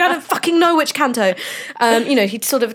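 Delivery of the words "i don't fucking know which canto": high-pitched exasperated retort; hammed-up frustrated student; mock exasperation. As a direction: retell a student's indignant comeback. Retold exasperated reply to tutor.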